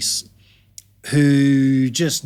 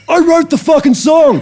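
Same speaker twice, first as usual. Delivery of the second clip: high, very loud mock outrage; full-voiced retold bellow; indignant shout; 9 dB louder than usual. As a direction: shout it like an affronted songwriter. Retold indignant retort of the songwriter.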